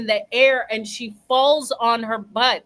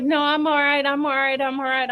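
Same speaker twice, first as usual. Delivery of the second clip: higher, run-on mock insistence; insistent brushing-off; 5 semitones higher, faster than usual. Tipsy friend assures the officer after her fall, mock insistence. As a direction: imitate someone insisting over and over, pitched up.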